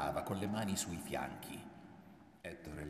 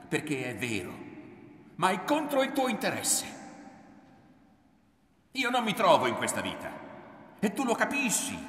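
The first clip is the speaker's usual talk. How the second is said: loud, raised, impassioned outburst; heated rant, surging higher and louder; angry tirade; 7 semitones higher, 9 dB louder than usual. A narrator's reading. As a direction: pour out the grievance at your mother. Son's angry tirade at his mother.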